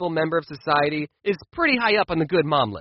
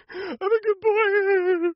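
put-on much higher, very slow, squeaky "good boy"; mock good boy, squeakier; 13 semitones higher, much slower than usual. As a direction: stretch "good" way up like a cooing toddler. Kid repeating it even sweeter.

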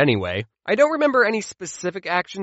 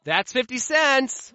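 slow mom verdict; 6 semitones higher, slower than usual. Retold or imitated. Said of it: "mom ruling".

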